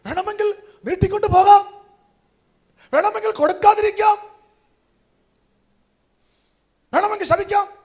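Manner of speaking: loud, high preaching call; rising shout. Acted. Preacher loudly tells the church the choices, shouting. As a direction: proclaim each choice at full voice.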